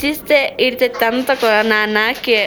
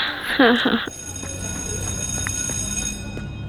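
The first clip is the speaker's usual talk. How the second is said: villain laugh; 9 dB quieter than usual. Acted. Plots something bad.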